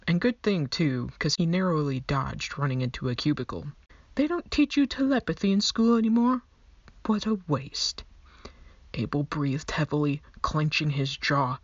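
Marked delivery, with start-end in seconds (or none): 4.1-8.0 higher, louder, disdainful fake accent; scoffing skeleton accent. A narrator's reading. Skeleton scoffing at Abel's ignorance in fake accent. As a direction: sniff at them like a snobbish clerk.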